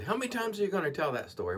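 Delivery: mild imitation of an impatient critic; mock heckler. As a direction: toss off a nagging viewer's jab. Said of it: "A few people are, will always say".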